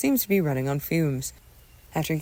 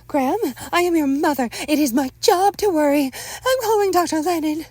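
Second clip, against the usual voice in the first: high, loud motherly insistence; overbearing mother; 13 semitones higher, 7 dB louder than usual. Acted. Mother overrules son and calls doctor, high forceful voice. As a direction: assert your authority, pitched well up.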